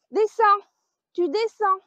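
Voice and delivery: firm call. Real, not acted. Shooing an animal off.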